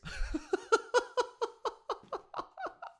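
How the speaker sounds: high-pitched giggle at his own story; high squealing laugh. Real, not acted. Comic laughing at his own phone case story.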